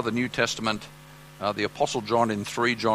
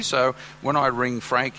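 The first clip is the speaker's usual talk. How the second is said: ordinary talk, nothing marked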